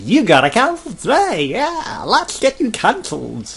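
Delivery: squeaky high, loud sales pitch; cheery pushy salesperson. Retold or imitated. Sky agent pushing cancellation, high cheery salesperson voice. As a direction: ham up an eager agent rushing him through.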